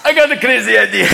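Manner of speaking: choked voice of someone holding in smoke; comic loaded cardinal; stoned cardinal. Acted. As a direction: pitch an idea mid-hit on a hookah. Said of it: "getting a little loaded".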